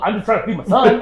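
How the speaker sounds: high, loud plea of a fed-up dad; mock-outraged self-defence; exasperated retelling. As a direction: protest loudly, replaying the argument. Retold argument with mom.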